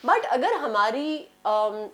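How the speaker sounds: ordinary delivery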